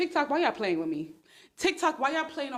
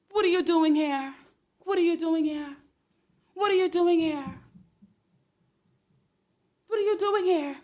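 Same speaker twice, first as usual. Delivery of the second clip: indignant calling out; 6 semitones higher than usual. Real, not acted. Calls out a man in comments.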